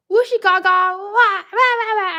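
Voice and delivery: put-on toddler tantrum. Imitating his toddler self's frustrated wail. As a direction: cry like a cross toddler who can't draw. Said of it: "that was actually the sound".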